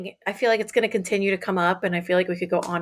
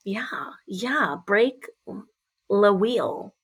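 normal talking voice

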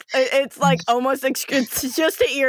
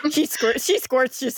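ordinary talk, nothing marked

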